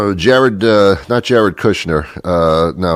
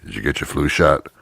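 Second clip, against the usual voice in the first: shady street dealer; 8 semitones lower, 5 dB quieter than usual. Pretend. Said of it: "I look for people with big heavy coats".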